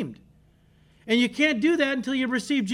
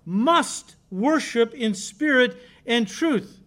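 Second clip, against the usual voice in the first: slow, each word weighted, spirit stressed; slow, insistent preacher's emphasis; emphatic; slower than usual. Real, not acted. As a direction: spell out Must worship in spirit and truth slowly. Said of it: "It's emphatic".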